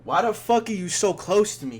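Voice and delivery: high, loud alarmed outburst; startled yell. Retold or imitated. Friend recoiling from the drunk homie, loud startled yell. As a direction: yell it, freaked out by the closeness.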